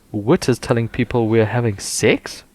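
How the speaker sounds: usual speaking voice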